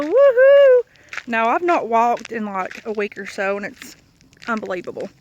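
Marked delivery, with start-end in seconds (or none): 0.0-0.8 a loud, high whoop of excitement; big happy woohoo, much higher and louder; excited cheer. Real, not acted. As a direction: cheer it out to kick off the video. Cheering at start of video.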